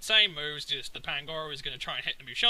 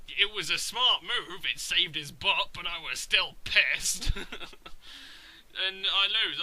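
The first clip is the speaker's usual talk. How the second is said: ordinary delivery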